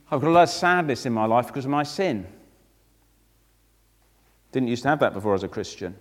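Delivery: normal talking voice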